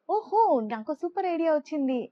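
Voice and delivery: high, loud burst of excitement; excited exclamation. A narrator's reading. Chitti announces a super idea to friends, excited. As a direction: blurt it out, thrilled by the idea. Character gets a mischievous idea.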